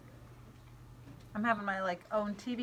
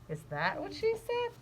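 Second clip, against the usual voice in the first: higher, sly questioning voice; father dodging; 10 semitones higher than usual. Acted. Father sidestepping Flora's question in a lightly higher voice. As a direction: turn it back as a question. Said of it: "conversation with her father".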